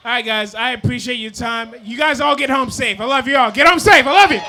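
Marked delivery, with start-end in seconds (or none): 1.8-4.4 building to a high, loud farewell shout; rousing sign-off. Real, not acted. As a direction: send the crowd home, louder with each phrase. Signs off to the crowd.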